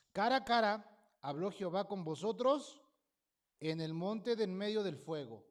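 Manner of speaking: loud, ringing preacher's reading; scripture read aloud. Acted. Preacher proclaiming the verse to his congregation, loud reading.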